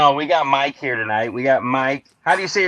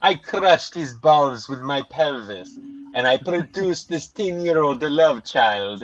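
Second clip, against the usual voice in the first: Olga; 2 semitones lower, 4 dB quieter than usual. Acted. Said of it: "introduce you to Olga Abdullah".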